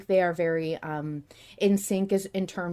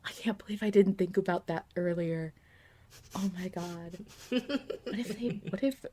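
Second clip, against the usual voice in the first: whisper; 8 dB quieter than usual. Real, not acted. Sudden realization, muttered to herself.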